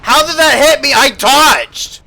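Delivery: high, loud, outraged disbelief; raging gamer. Real, not acted. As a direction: yell at the unfair hit. Raging at hitbox.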